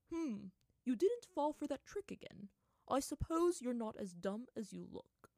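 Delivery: loud put-on monkey voice; lightly acted spirit, condescending; monkey spirit, grudging. A narrator's reading. Monkey spirit sizes up Aang, grudging.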